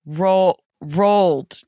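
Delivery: rolled with a long O, set out on its own; rolled rhyming with cold; pronounced as spelled. Shown as a demonstration. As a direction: sound out Roll, then rolled, deliberately. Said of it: "pronounced pretty much as it's spelled".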